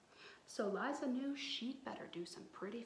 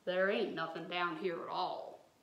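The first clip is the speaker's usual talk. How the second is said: lightly voiced swamp devil, a bit louder and lower; half-hearted mock holler up from the jug; 4 semitones lower, 5 dB louder than usual. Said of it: "hollered up that swamp devil".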